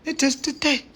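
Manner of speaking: high, mock-British answer; cheeky put-on London voice; fake British accent. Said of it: "is this your real fucking voice?".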